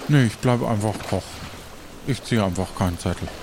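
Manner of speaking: ordinary delivery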